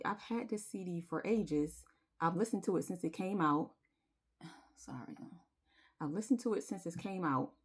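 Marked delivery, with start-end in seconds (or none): 4.4-5.5 whispered, choked-up sorry; emotional apology. Real, not acted. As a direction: apologize softly while welling up. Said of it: "I'm getting emotional right now".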